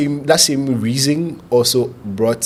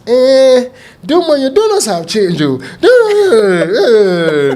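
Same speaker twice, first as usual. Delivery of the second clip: much higher, louder mock customer protests; complaining customers; 12 semitones higher, 6 dB louder, slower than usual. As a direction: whine like annoyed customers.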